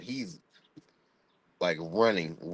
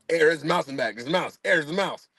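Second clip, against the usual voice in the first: panicked dad; 5 semitones higher, faster than usual. Retold or imitated. Dad panicking over mouse.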